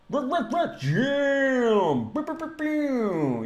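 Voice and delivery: high squeaky comic voice; silly high voice. Acted. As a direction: squeak it out playfully.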